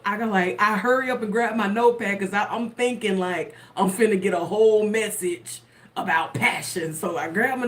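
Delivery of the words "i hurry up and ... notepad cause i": ordinary talk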